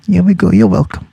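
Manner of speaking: ordinary talk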